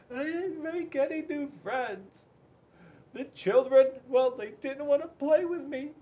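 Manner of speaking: put-on high, small child's voice, close to tears; little girl voice. A narrator's reading. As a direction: voice Penelope as a sad little girl. Penelope voiced in story.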